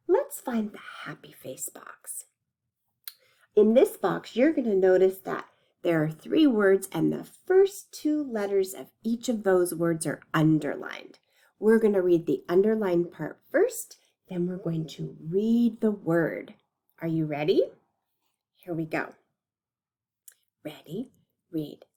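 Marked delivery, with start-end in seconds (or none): 0.1-2.3 soft unvoiced whisper. Real, not acted. Teacher whispers an instruction. 18.6-21.9 hushed whispered cue. Real, not acted.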